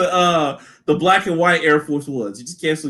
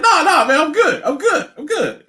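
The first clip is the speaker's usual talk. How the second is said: higher, dismissive friend voice; mock confident; 10 semitones higher than usual. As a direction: brush it off, cocky. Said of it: "And you're like".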